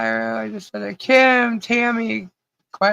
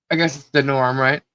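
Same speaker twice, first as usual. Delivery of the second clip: ordinary talk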